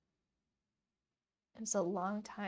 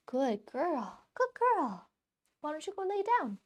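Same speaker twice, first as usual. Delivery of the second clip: high pet-talk; 6 semitones higher, 4 dB louder than usual. Real, not acted.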